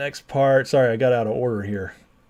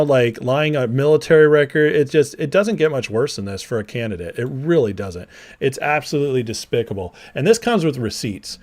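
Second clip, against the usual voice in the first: ordinary talk, nothing marked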